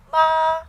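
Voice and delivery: MA high, loud and level; first tone. Shown as a demonstration. Teacher reviewing all tones, high level MA. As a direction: hold MA steady up high. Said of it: "First tone".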